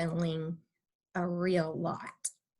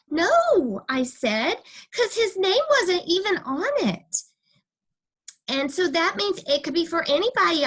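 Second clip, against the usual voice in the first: insistent; 8 semitones higher, 4 dB louder than usual. A narrator's reading. Junie B defending herself to Missus.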